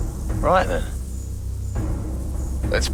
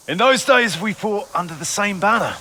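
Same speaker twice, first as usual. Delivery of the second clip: high, raised parley voice; calling out; 10 semitones higher than usual. Acted. Knight reminds his foe of old days, calling out. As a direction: project it high across to the other side. Calls across to enemy at parley.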